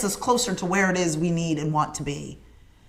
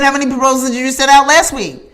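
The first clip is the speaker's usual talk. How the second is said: louder, higher pointed question; pressing sister; 5 semitones higher, 6 dB louder than usual. Retold or imitated. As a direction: corner her with the question. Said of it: "She said".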